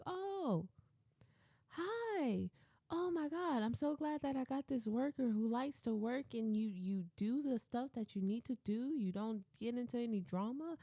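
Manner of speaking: high, soft, sugary welcome; gushing greeting. Retold or imitated. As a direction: imitate an overly sweet manager meeting someone new. Manager gushing at first meeting.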